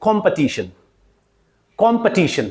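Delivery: "competition" as kom-puh-TI-shun, twice; full KOM and schwa puh in "competition"; correct pronunciation demo. Shown as a demonstration. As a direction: model "competition" with weak second syllable. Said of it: "It will be pronounced as".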